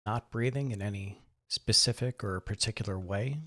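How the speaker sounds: calm, measured voice with long pauses; guided meditation. Acted. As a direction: gently guide listeners through breath and body, softening as they settle. Leads a guided breathing meditation.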